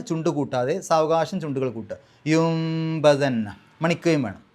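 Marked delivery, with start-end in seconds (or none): 2.3-3.5 യുംബദന്ന very slow, lips closing gently on m; careful tajweed demo; slow iqlab demo. Shown as a demonstration. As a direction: recite യുംബദന്ന slowly with a humming m. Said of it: "സാവകാശം മീമിലേക്ക് പോവുക".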